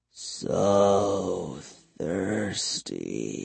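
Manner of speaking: very slow, low, soft vampire voice; thirsty vampire murmur. A narrator's reading. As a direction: murmur it low, stretching each word. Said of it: "he murmured".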